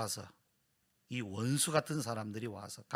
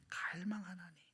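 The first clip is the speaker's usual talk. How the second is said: softer whispered repeat of 갈망하나니; whispered echo; 5 semitones higher, 10 dB quieter than usual. Acted. Pastor echoing the psalmist's longing, whispered. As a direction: breathe 갈망하나니 again softly.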